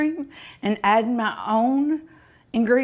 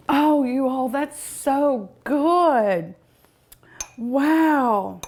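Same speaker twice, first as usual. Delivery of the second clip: drawn-out, higher, savoring; slow savoring delight; 4 semitones higher, much slower than usual. Real, not acted. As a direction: stretch it out, relishing each word. Savoring ice cream.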